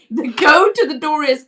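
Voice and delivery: normal talking voice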